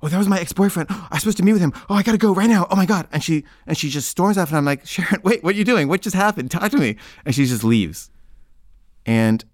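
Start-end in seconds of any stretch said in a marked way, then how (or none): 0.0-3.1 high-pitched, agitated excuse; acted-out frantic leaving; flustered rush. Flustered girlfriend rushing to leave. 4.9-6.9 higher-pitched, bewildered appeal; acted-out plea to stop and talk; urgent pleading. Pleading after her as she leaves.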